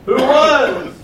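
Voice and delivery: high, loud preacher emphasis; proclaiming. Acted. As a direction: hit the phrase hard and high. Preacher proclaims God's eternity.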